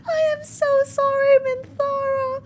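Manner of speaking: very high, loud squealed apology; real squeaky, emotional outburst; freaking out. Real, not acted. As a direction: wail the apology, overwhelmed. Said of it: "I'm freaking out".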